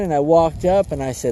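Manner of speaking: normal talking voice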